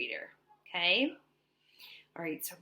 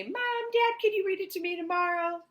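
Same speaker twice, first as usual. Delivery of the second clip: put-on higher, louder childlike asking; pleading child; 11 semitones higher, 5 dB louder, faster than usual. Teacher voicing a child begging parents to read to them. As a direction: beg like a kid at bedtime. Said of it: "maybe you say".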